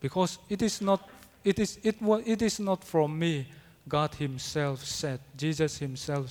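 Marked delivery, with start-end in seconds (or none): none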